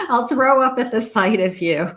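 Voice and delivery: half laughing, mock disgust; amused mock insult. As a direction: repeat it through leftover laughter. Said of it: "repeats the mean remark, amused".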